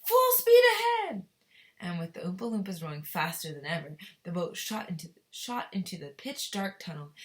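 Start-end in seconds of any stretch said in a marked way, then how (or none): 0.0-1.3 very high, loud, slow shout; shouted command. Acted. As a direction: bellow the order, stretching it out. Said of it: "shouted Mister Wonka".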